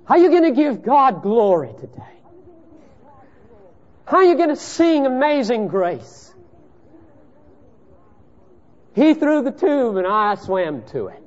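high, loud pulpit preaching; preaching at full force. Acted. Preacher pressing the congregation on faith, in full voice. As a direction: drive the point home from the pulpit.

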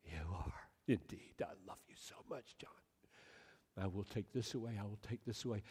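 hushed, near-whispered reassurance; tender reply. Acted. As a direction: answer a hurting child softly and lovingly. God answers the child tenderly.

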